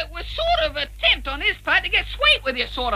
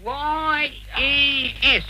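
very slow, drawn-out letters; low, letter-by-letter read-aloud; spelling out; 4 semitones lower, much slower than usual. Acted. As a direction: spell it slowly as it gets written. Spelling out letters being written.

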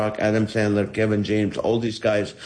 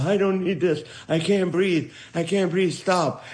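lightly voiced grandpa, pitched up; mock-protesting grandpa who can't breathe; complaining grandpa; 8 semitones higher than usual. Lungs pleading with the runner as grandpa, lightly voiced higher complaint. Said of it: "my lungs were like, The grandpa".

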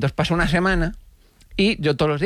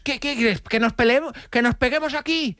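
aggressive stranger; 7 semitones higher than usual. Retold or imitated. Retold aggressive confrontation.

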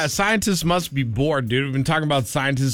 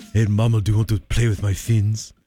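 low, put-on French accent, hitting on a mermaid; French whale flirting with a mermaid in a low, put-on French accent; flirty French whale; 7 semitones lower, faster than usual. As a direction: croon it in a cheesy French accent. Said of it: "you're trying to hit on a mermaid".

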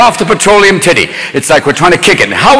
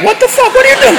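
shrill disbelieving cry, far higher; outraged squeal. Acted. Comic shrieking at the banks in outrage. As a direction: screech at them in disbelief.

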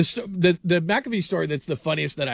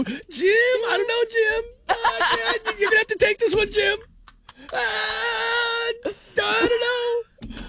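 high, whiny, lost broadcaster calling Jim; mock helpless announcer; slower than usual. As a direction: squeal for Jim's help. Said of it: "just go".

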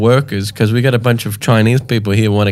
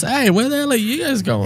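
mock yell; 14 semitones higher than usual. Retelling locals yelling from afar.

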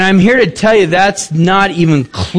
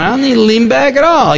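pitched-up indignant retort; mock retort; 7 semitones higher than usual. Preacher retelling his reply to the revival man, indignant. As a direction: fire it back at him, higher.

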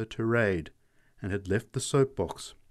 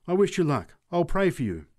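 higher and louder, pressing the wish; an eager, lifted prayerful wish; fervent, raised wish; 7 semitones higher, 4 dB louder than usual. Acted. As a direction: push the blessing up with fervour. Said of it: "she muttered fervently".

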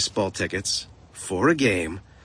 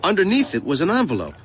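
ordinary delivery

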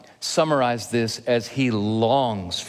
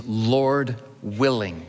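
slow, weighty "Lord willing"; much slower than usual. Shown as a demonstration. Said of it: "what we learn to pray from the Bible is".

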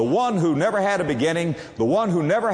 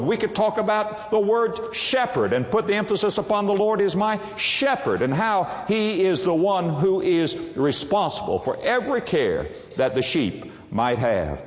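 normal talking voice

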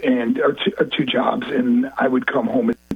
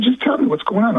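retold urgent plea, pitched high; urgent demand; 8 semitones higher than usual. Mark on the phone to the States, urgently pressing. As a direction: press anxiously for an answer.

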